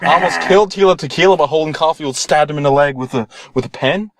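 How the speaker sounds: normal talking voice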